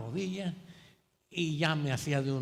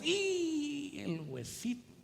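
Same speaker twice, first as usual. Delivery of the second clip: queja cómica; 10 semitones higher, 4 dB quieter, much slower than usual. Retold or imitated. Queja cómica por la rodilla.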